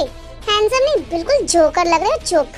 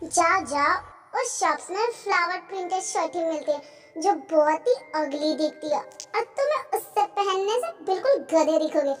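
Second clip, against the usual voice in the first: much softer, private inner voice; hushed self-congratulating aside; talking to herself; 9 dB quieter than usual. Acted. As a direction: think it aloud under your breath. Character's scheming thoughts to herself.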